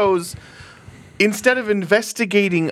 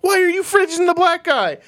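incredulous protest; 12 semitones higher than usual. Retold or imitated. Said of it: "you're like".

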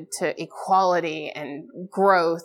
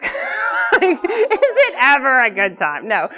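slow laughing question, pitched up and louder; 7 semitones higher, 5 dB louder, slower than usual. Real, not acted. To the audience, mocking race-day fun, laughing slowly. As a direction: laugh through the words, slowly.

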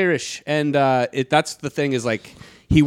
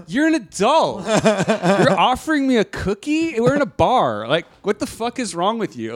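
incredulous mock rant; 8 semitones higher than usual. Retold rant at friend.

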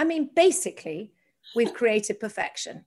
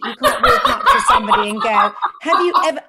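louder, laughing through the husband's scolding; laughing retelling; 4 semitones higher, 8 dB louder than usual. Retold or imitated. Quoting husband Graham scolding her, laughing loud. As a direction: giggle out the husband's warning. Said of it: "My husband said".

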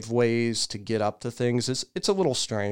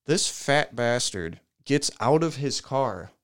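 usual speaking voice

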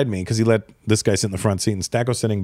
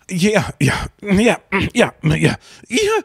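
mock a severe stutter, climbing much higher; extreme stutter; 13 semitones higher, slower than usual. Raj answering about college next, badly stuttering. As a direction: stick on one word, repeating it with strained stretches. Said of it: "Hey, he stutters".

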